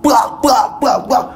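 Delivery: high punchy bangs; mock fight noises. A narrator's reading. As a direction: chant the bangs like blows landing. Fight sound effect narration.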